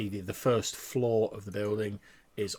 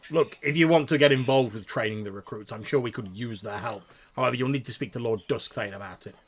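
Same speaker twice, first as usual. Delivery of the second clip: restrained anger; 3 semitones higher, 7 dB louder than usual. Acted. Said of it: "a look of anger cross his face".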